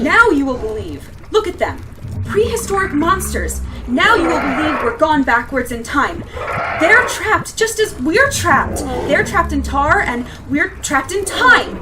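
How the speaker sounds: rising, despairing cry; despairing shout. Acted. She insists in rising despair.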